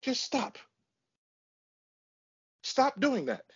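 high-pitched, insistent order; exasperated. Real, not acted. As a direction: cut off your co-host's excuses. Still ordering co-host to stop.

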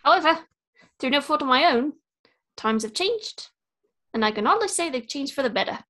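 put-on light New Zealand Korg accent; relaxed Korg storytelling, New Zealand accent. Friend guessing game, easygoing Korg in a New Zealand accent. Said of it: "trying to be Korg from Thor Ragnarok".

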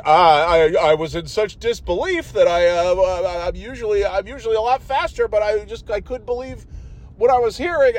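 mock Coach Jeff. Imitating Coach Jeff excusing himself, high flustered voice. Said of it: "And he's like".

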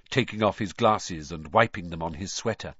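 plain reading voice

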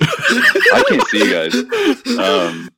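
high laughing, drawn out; nervous laugh. Real, not acted. Laughs as the video drops.

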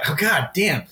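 ordinary talk

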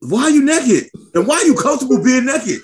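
high, exasperated questioning; incredulous. Real, not acted. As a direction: ask it in disbelief, pitch climbing. Incredulous rant about gym.

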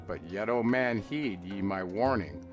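ordinary delivery

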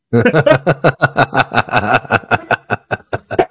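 cartoon monster laugh; Mike Wazowski laugh. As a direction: laugh like the character. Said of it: "So I can do the laugh".

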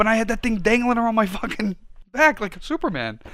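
loud, high-pitched proud declaration; worked-up boasting. Real, not acted. Boasting to friend of his Italian flag, worked up. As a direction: proclaim it at the top of your range.

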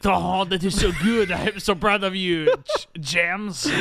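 high, loud, hammed-up adoration; exaggerated proud squeal, breaking up on the name; mock gushing girlfriend. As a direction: gush like an overacting movie girlfriend.

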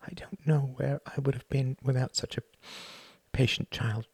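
mother's grateful words catching in a whisper. Acted. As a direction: let the voice break down into a whisper. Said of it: "Her voice caught".

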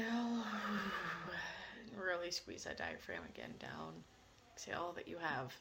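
soft, unhurried breath coaching; guided breathing. Acted. Leads breathing at meditation start.

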